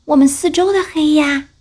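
high, small scared cub voice; a timid little cub afraid of the dark; little bear voice. Acted.

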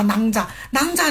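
囊家 said with a nasal ang instead of nan, slowly; wrong ang sound. Shown as a demonstration. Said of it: "千万不要读成囊家".

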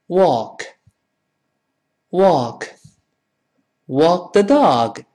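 slow "walk" with open American ah; read out loud. Shown as a demonstration. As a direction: draw out each "walk" for repeating. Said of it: "我们大声读一下这个词".

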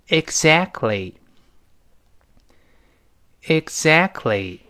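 "Exactly" stretched out slowly, falling at the end; slow read-along. Shown as a demonstration. Teacher leading learners to read along, slow model. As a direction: model "Exactly" unhurriedly for repetition. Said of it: "先跟老师大声读起来".

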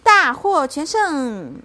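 loud, high, drawn-out cheer; stretched-out victory cry; triumphant. A narrator's reading. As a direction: proclaim victory slowly and proudly. Child celebrates the victory.

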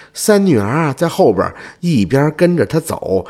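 ordinary delivery, nothing marked

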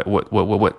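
"would" quick and weak, final d dropped; very fast weak form. Shown as a demonstration. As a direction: rattle off a reduced "would". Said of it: "把这个would读得非常快". (快速弱读)

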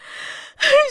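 choked sob. Acted. Mother breaks down over daughter.

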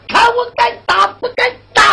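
pitched high, the fury peaking; final furious challenge at full height; climactic threat. Acted. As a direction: hurl the threat at the top of the voice. Threat repeated, rising each time.

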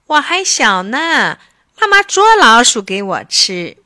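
loud spoiled-kitten wheedle; pampered, cutesy insistence; coquettish childlike pleading. A narrator's reading. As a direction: wheedle like a babyish child expecting to be fed. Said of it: "小猫咪又撒娇说".

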